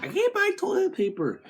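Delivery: squeaky high whine of a panicking shopper; mock the hoarders in a tiny high voice; whiny panicked shopper. Mocking toilet paper hoarders, squeaky high whine. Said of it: "everyone's like".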